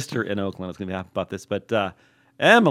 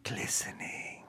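whisper; 16 dB quieter, much slower than usual. Acted. Whispered aside about party.

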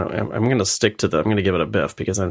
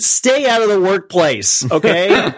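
mock scolding; 9 semitones higher, much slower than usual.

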